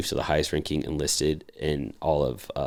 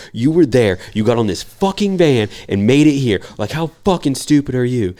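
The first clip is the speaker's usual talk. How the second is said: imitating a superior's loud, high chewing-out; retold angry screaming; 8 semitones higher, 8 dB louder than usual. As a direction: berate the newcomer at raised pitch and volume. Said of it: "start getting fucking screamed at".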